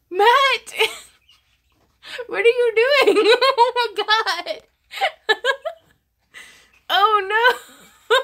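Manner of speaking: high, loud, laughing disbelief; laughing excited shout. Real, not acted. Streamer shouts at Matt over a big gift, high loud laughing disbelief. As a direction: cry out through laughter at the gift. Shocked by Matt's donation.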